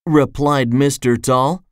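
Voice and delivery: usual speaking voice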